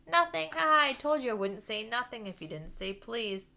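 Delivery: high, loud triumphant jeer; gloating poltergeist. Acted. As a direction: crow over the trick. Peeves gloating at Filch.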